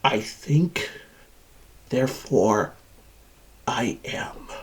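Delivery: whispered, with long pauses; hushed revelation. Acted. Machine recalls waking to itself, whispered awe. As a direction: let each phrase drop into a whisper. Machine declares its self-awareness.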